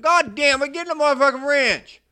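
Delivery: very high, very loud cursing yell. Retold or imitated.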